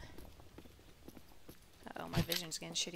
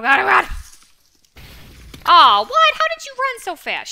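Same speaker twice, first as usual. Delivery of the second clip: startled shout; 13 semitones higher, 25 dB louder than usual. Real, not acted. Startled by a fast enemy.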